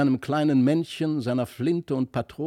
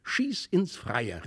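high, drawn-out command; boy's eager order, higher voice; called-out command; 8 semitones higher, slower than usual. A narrator's reading. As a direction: order it out eagerly, pitched up and slow. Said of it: "rief er".

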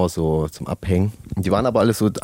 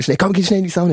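urgent mock hurry; 8 semitones higher, faster than usual. Friend urging him into sauna.

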